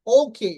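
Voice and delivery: "ওকে" loud, as ou-khey; airy k and glide in "ওকে"; correct pronunciation. Shown as a demonstration. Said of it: "not ওকে". ("ওকে" ওউ-খেই-এর মতো)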